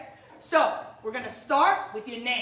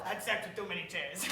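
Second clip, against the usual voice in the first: quiet fast aside; 8 semitones lower, 13 dB quieter, faster than usual. Acted. Mumbled joke aside.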